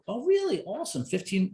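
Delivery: high, sing-song mock enthusiasm; mock impressed. Coach teasing the young client, mock impressed and high. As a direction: act wildly impressed while teasing.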